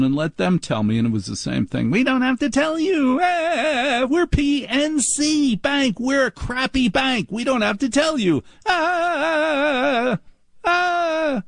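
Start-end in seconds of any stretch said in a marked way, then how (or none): 1.9-11.4 high, drawn-out snooty clerk voice; mocking bank clerk. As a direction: lampoon the bank as smug and proud of it. Mimicking smug bank supervisor.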